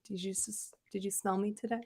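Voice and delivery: soft, whispery flirt; husband fishing for a compliment, hushed; hushed vain question. Retold or imitated. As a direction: breathe it out coyly. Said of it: "put extra cologne on".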